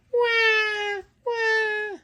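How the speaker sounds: very high mock baby wail; baby crying. Dad mimics a baby's cry for his toddler, high and playful. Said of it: "what's a baby say".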